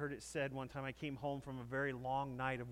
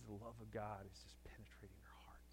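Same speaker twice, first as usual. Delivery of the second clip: whispered, much softer; reverent whisper; 2 semitones lower, 8 dB quieter than usual. Real, not acted. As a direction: breathe it out like a secret of grace. Preacher's reverent hushed line.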